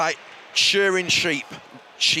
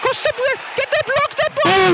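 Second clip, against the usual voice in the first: excited shout; 5 dB louder than usual. Real, not acted. Reacting to the match.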